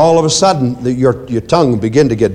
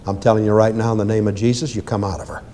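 softer, fast quiet rebuke; quiet command; 6 semitones lower, 6 dB quieter, faster than usual. Shown as a demonstration. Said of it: "You just speak it quietly".

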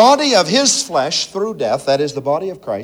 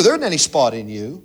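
usual speaking voice

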